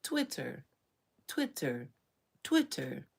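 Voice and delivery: even machine gun syllables; glitching robot. Retold or imitated. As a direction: repeat each word like a stuck machine. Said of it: "Gen Zs and Gen Alphas glitching".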